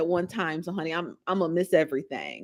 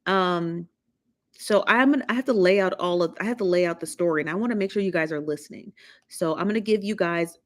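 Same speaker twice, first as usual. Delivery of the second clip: ordinary delivery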